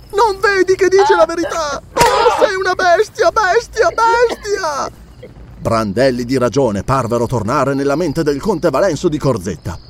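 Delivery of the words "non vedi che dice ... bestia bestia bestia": very high, frantic outraged shrieks; screaming. A narrator's reading. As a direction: scream accusations at your uncle. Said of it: "urlava Belesa".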